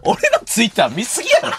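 high, loud laugh breaking into the words. Real, not acted. Host cracking up at his own tweets being read, laughing loudly. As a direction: laugh it out in disbelief.

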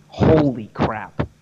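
drawn-out, slow astonishment; slow amazed holy crap. Real, not acted. Marveling slowly at how easy Spanish is. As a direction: let it sink in slowly, awed.

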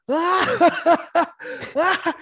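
high acted burst of laughter; big high-pitched mock cackle; uncontrollable laughing. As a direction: imitate a helpless laughing fit. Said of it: "And I started laughing".

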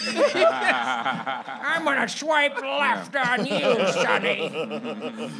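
put-on very slow, loud, laughing old codger; curmudgeon-y old man. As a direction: drag it out like a cranky grandpa. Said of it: "You're a Jeff Dunham puppet".

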